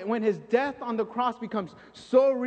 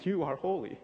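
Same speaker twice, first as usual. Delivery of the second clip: hushed, fading into quiet awe; awed worship; 6 semitones lower, 3 dB quieter than usual. Retold or imitated. Worshipper in God's presence, fading to a hushed awe. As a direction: let the praise fall away softly. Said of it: "sing and be like".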